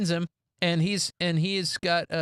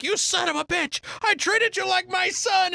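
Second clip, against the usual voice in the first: betrayed producer; 12 semitones higher than usual. Retold or imitated. Producer blaming the actor, higher hurt anger. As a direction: spit it at the traitor. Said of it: "the producer's like".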